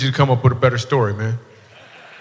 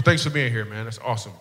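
ordinary talk, nothing marked

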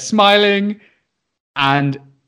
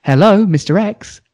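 slow, higher, falsely cheery hello; breezy innocent greeting; 5 semitones higher than usual. Retold or imitated. Teacher finally gets through to the dads, acting breezy as if nothing happened. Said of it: "as if nothing had happened".

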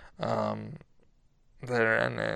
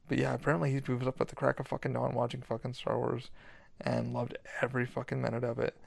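ordinary talk, nothing marked

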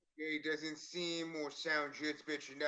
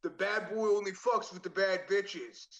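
slow, louder, hammed-up bad boy; bad-boy come-on; 4 semitones higher, 4 dB louder, slower than usual. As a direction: draw out the cheesy come-on. Performing cheesy bad-boy message.

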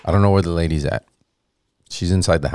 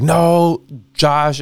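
exasperated mom; 9 semitones higher, 4 dB louder than usual. Retold or imitated.

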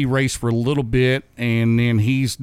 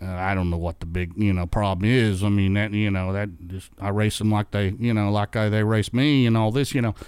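lower, softer voice, a touch of playing dumb; mildly voiced sheepish old racer, lower and softer; sheepish innocence; 6 semitones lower, 3 dB quieter than usual. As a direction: act like you can't see why they're upset. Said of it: "he had that sheepish, sheepish grin".

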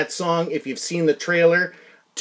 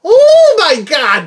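excited drawl; 9 semitones higher, 8 dB louder, much slower than usual. Real, not acted. Excited about the film.

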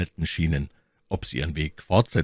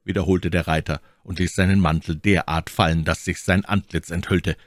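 usual speaking voice

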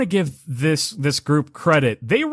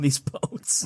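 laughing; 6 dB quieter than usual. Real, not acted. Laughing through the narration.

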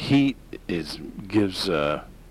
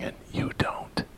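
whispering; 8 dB quieter than usual. Real, not acted. Taunting a rival pundit.

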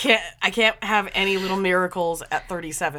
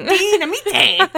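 high, loud, drawn-out cartoon squirrel; Hoodwinked squirrel; 10 semitones higher, 12 dB louder, slower than usual. Teasing an over-excited friend, squirrel impression. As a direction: shriek it like a hyperactive squirrel. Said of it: "that little squirrel from Hoodwink".

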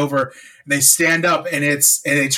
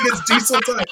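high-pitched mock scream through laughter; hammed-up high catchphrase, not truly screamed; imitated screaming; 8 semitones higher than usual. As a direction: laugh while imitating a silly yell. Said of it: "he just starts screaming".

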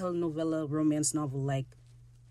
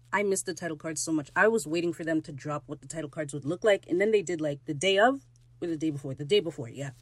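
ordinary delivery

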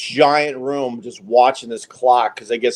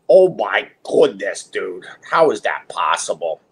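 mock disbelief; 6 semitones lower than usual. Mock shock at being called liberal.

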